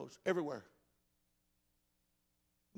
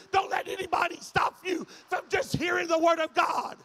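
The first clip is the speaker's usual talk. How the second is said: loud, high, shouted sermon lines; full-force preaching; 9 semitones higher, 8 dB louder than usual. Acted. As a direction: preach it like the church is on fire.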